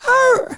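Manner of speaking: high yelp of a hit dog; imitated dog yelp. Retelling the crash to a friend, high yelping dog cry. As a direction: squeal it like a struck little dog. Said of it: "The dog go".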